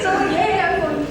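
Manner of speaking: ordinary talk, nothing marked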